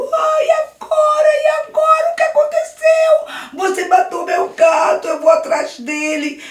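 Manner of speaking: very high, loud crying shout; Daniel wailing after his cat falls; wailing sob. Acted. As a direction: sob out the despair. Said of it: "começou a chorar, bem forte mesmo".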